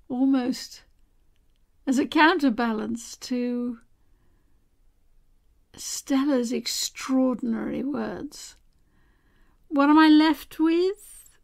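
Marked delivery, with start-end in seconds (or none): 0.0-8.5 slow, measured delivery with long pauses. Real, not acted. Host thanks her guest, countering her extraordinary words, very slowly. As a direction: take it very slowly, letting each phrase settle. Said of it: "I'm talking very, very slowly".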